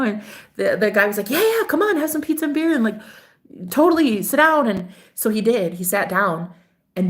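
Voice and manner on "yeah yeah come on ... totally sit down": eager invitation. Retold or imitated. Quotes date's eager invitation.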